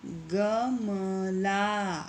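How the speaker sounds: गमला stretched very slowly, syllable by syllable. Shown as a demonstration. Teacher to pupils, sounding out गमला very slowly. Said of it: "इसी तरह से ये आगे के जो शब्द हैं".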